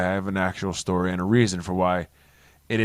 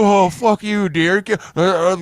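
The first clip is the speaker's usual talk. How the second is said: high, loud taunting bully voice; mocking bully; 9 semitones higher, 6 dB louder, slower than usual. A bully jeering mid-fight at the kid who hits him. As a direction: jeer it up high and loud like a punk getting beaten. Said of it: "They'd be like".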